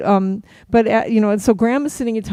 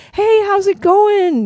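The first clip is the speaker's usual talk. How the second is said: high, loud, drawn-out hey; effusive greeting; 13 semitones higher, 7 dB louder, slower than usual. Retold or imitated. Singer greeting a fan with a hug, effusive hello. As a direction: imitate a gushing hello.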